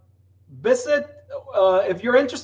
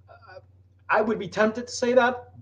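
ordinary talk

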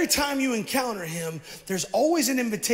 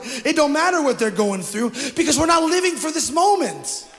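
loud, high preacher's exhortation; fiery preaching; 5 semitones higher, 5 dB louder than usual. Real, not acted. Pastor rousing the congregation, loud and high. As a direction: preach it out to the crowd.

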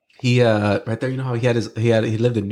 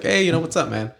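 higher-pitched, easy retold hello; casual greeting; 6 semitones higher than usual. Kobe greeting the nervous friend, casual imitated hello. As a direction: toss out a friendly star's greeting.

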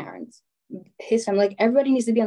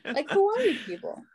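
stunned disbelief; 10 semitones higher than usual. Retold or imitated. Stunned at parents' reaction.

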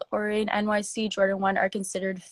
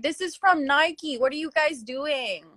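higher, teasing scold at the brand; mock exasperation; 7 semitones higher than usual. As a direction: playfully scold Nike like a let-down friend. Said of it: "And I was like".

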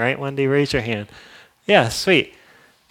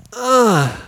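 disgusted ugh; 10 semitones higher, 6 dB louder than usual. Retold or imitated. Reacting to gossip.

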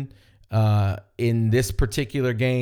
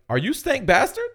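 retold surprised question; 11 semitones higher than usual. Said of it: "he's like".

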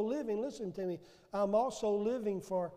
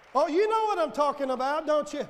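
usual speaking voice

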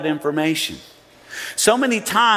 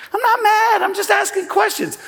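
defensive. Pretend. Mocking a blunt texter.

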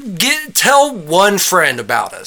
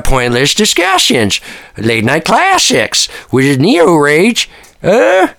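hyped promo; 4 dB louder than usual. Acted. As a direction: sell it like a TV pitchman.

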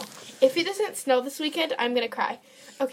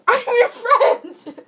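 high, loud, slow laughing objection; laughing protest; 9 semitones higher, 5 dB louder, much slower than usual. Real, not acted. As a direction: protest through real laughter.

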